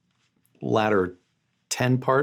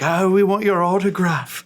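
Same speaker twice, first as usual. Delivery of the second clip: louder, higher showy star voice; put-on voice; 10 semitones higher, 5 dB louder than usual. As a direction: play a pompous celebrity. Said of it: "why are you talking like that".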